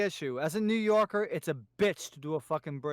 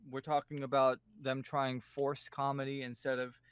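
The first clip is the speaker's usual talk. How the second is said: usual speaking voice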